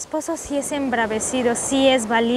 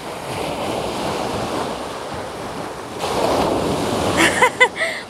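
high airy fit of laughter; long breathless laugh; 9 semitones higher than usual. Real, not acted. Husband hit by waves.

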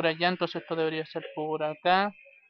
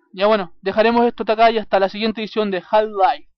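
energetic outro; 4 semitones higher, 9 dB louder than usual. Real, not acted. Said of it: "outro sign-off to viewers".

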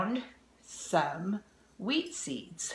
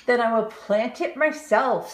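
determined hen; 4 semitones higher, 7 dB louder than usual. Acted. Hen's determined reply.

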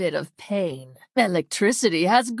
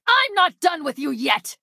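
high, indignant villain's cry; angry threat; 6 semitones higher than usual. Acted.

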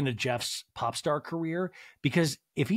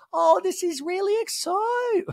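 much higher, put-on Jennifer Tilly voice; mangled Jennifer Tilly; 4 dB louder than usual. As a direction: badly mimic her squeaky gush. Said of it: "I'm just gonna mangle it".

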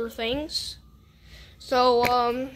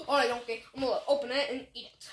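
ordinary talk

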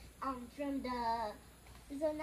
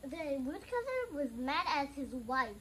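ordinary delivery, nothing marked

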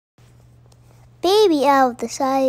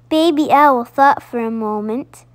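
ordinary delivery, nothing marked

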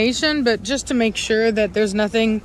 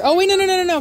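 sudden alarm; 9 semitones higher, much faster than usual. Real, not acted. Wrong dog let out.

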